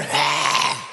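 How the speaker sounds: loud throat-clearing hack; hammed-up preacher throat hack; phlegm hack. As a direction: hack the phlegm out mid-sermon. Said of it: "phlegm caught in his throat".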